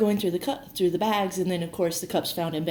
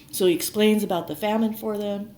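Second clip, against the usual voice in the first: normal talking voice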